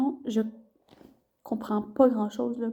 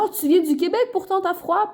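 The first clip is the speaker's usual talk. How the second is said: higher, mock-surprised teasing; teasing students; 6 semitones higher than usual. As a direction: imitate the classmates ribbing her about the cold. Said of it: "Ils sont comme".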